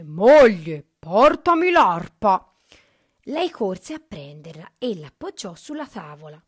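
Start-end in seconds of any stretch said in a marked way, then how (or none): 0.0-2.5 booming, domineering command; loud ogre order. A narrator's reading. Ogre orders his wife to fetch the harp, very loudly. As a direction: bellow the order, drawing out the end. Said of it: "L'orco poi ordinò a gran voce".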